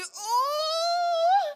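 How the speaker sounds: sudden loud high cry of pain. Acted. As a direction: yelp as the contraction hits hard. Contraction while leaving restaurant.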